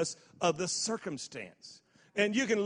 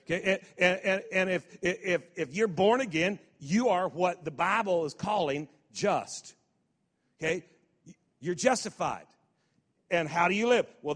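ordinary talk

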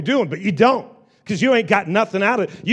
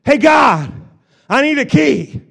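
loud, high cry to God; raised, pleading shout upward; calling out; 6 semitones higher, 5 dB louder than usual. Acted. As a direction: holler for help across a crowd. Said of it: "he's calling out to the Word".

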